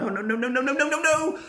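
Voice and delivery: rising anxious string of no; panicked no. Retold or imitated. Fan fearing her favourite gets thrown out, rising panic. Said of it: "I was like".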